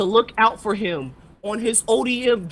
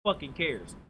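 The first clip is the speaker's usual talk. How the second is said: dismissive mutter; 8 semitones lower, 10 dB quieter than usual. Retold or imitated. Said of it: "they go like".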